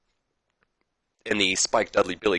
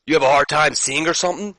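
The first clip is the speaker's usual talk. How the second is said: acted-out irate wrestler, higher and louder; mock indignant challenge, pitched up; pissed off; 8 semitones higher, 5 dB louder than usual. Saturn confronts Funaki over the spilled drink, pissed off. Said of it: "And Saturn's pissed".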